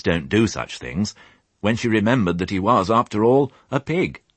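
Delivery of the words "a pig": ordinary delivery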